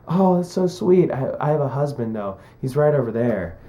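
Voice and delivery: a hint of a gracious actress; imitated sweet letdown. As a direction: imitate a sweet, polite brush-off. Voicing actress's kind refusal.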